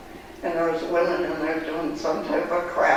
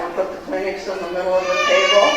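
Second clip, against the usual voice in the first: ordinary talk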